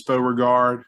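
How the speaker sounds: ordinary talk, nothing marked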